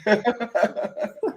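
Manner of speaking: sudden high-pitched laugh. Real, not acted. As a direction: burst out laughing. Laughs at guest crunching.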